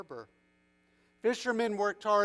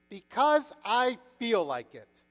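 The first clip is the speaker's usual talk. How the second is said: slow, louder, higher retort; defiant; 5 semitones higher, 6 dB louder, slower than usual. A narrator's reading. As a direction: brush off authority, leaning on the words. Fisherman defies the prince.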